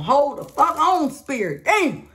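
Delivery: real exasperated shout at Spirit; exasperated outburst. Real, not acted. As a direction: throw your hands up at Spirit and yell it. Overwhelmed by too many cards.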